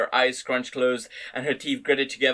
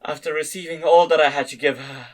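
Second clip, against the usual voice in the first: ordinary delivery, nothing marked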